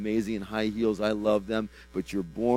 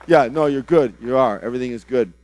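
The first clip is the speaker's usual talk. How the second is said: much louder, insistent comforting; loud reassurance; 3 semitones higher, 13 dB louder than usual. Real, not acted. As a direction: boom out you're good to settle her. Reassuring an overwhelmed woman.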